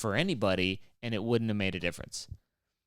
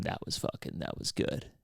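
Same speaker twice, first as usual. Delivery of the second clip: unvoiced whisper to a neighbour; whispered aside; 7 semitones lower, 6 dB quieter than usual. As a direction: lean over and whisper. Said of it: "they lean to the person next to them".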